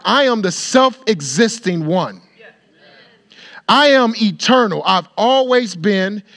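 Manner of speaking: fervent preacher's build; preaching at full force. Acted. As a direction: proclaim it boldly to the congregation. Preaching God's self-declaration.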